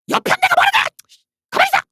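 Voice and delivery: very high, loud shout at the wife; mock husband yell. Pretend husband shouting his wife into silence.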